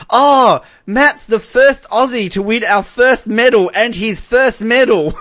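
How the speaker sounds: gushing interviewer, high, loud and drawn-out; mock an overexcited reporter gushing slowly; Rachel Finch. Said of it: "she says to the grandfather".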